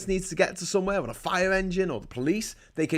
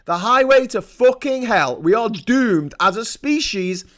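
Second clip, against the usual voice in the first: shouted, louder and higher; loud outrage; 5 semitones higher, 8 dB louder than usual. Real, not acted. Ranting to viewers about climate inaction, loud outrage. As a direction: hammer the warning home. Angry emphasis on climate warning.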